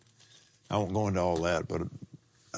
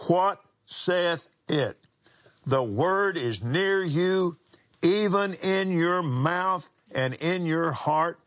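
slow, high, emphatic scripture reading; preaching; 7 semitones higher, much slower than usual. Acted. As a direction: preach it out slowly.

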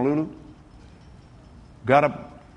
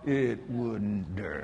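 calm defiance; 6 semitones lower, 8 dB quieter, much slower than usual. Retold or imitated.

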